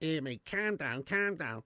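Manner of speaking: lightly put-on Scouse accent; a quick, half-hearted Scouse accent; mock Scouser. Streamer answering a chat message with a light Scouse accent. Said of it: "Love Harry Enfield and Chums".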